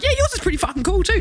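high-pitched, broad Australian reply; full Aussie accent. Retold or imitated. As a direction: gush back in exaggerated Aussie. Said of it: "Like, full Aussie".

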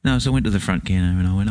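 ordinary talk, nothing marked

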